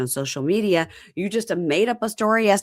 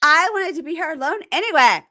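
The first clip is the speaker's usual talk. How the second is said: mock huffy girl; 10 semitones higher, 5 dB louder than usual. Quoting her parting jab.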